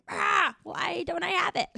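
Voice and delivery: high-pitched mock wail of protest; exaggerated, playful complaint. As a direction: whine it high like a frustrated body talking. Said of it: "whole body is like".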